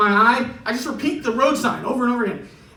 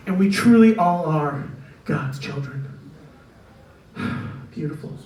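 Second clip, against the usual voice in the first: hushed hunting show host; 5 semitones lower than usual. Retold or imitated. Mock hunting show host narration.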